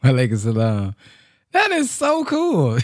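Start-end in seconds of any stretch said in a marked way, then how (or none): 1.5-2.8 high, laughing and amazed; laughing delight. Real, not acted. Host amazed by caller's British accent, laughing delight.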